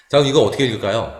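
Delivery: ordinary talk, nothing marked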